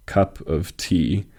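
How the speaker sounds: slow "cup of tea", landing on "tea"; stress on tea. Shown as a demonstration. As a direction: draw it out to show the beat on "tea". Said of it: "the word tea receives the emphasis".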